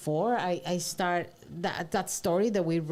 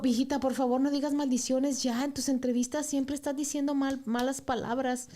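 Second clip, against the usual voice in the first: higher scolding mother voice, pleading; a mom begging her daughter, mock stern; imitating mom; 6 semitones higher than usual. Mother scolding daughter about swearing, mock pleading. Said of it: "mi mamá me dijo".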